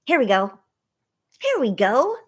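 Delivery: ordinary delivery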